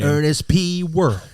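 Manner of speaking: loud, high comic-character impression; Ernest P Worrell. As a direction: belt out the goofy character's name. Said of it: "My underrated movie is Ernest Saves Christmas".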